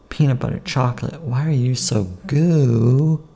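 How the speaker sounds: usual speaking voice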